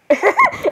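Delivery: high-pitched burst of laughter; excited laugh. Real, not acted. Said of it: "that laughter was a bit too loud".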